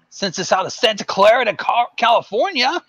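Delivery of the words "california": ordinary talk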